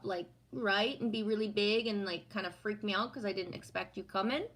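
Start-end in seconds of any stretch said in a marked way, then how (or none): none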